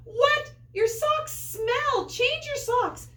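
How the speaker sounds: high, loud, slow disgusted complaint; disgusted kids. A narrator's reading. Kids at school complaining about the smelly socks, disgusted. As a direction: complain like grossed-out children.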